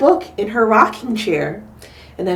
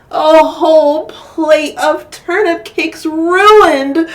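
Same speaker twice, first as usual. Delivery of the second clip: whining little Chan; 7 semitones higher, 6 dB louder, slower than usual. Acted. Child lamenting in story.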